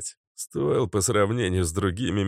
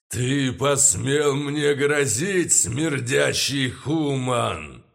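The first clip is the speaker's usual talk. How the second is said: angry pompous lich; 6 semitones higher, much slower than usual. Acted. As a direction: thunder with wounded pride. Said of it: "Гневно и с пафосом перебил архилич".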